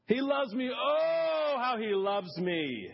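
swelling up into a high, joyful cry; rising joy. Real, not acted. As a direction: let the oh burst out high, then slow right down. Exulting over God's love.

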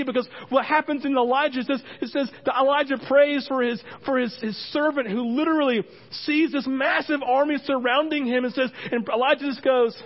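high, loud, driving sermon delivery; full-force preaching. Real, not acted. Pastor telling congregation of Elijah's servant, preaching hard. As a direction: tell it with urgent full voice.

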